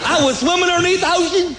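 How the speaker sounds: usual speaking voice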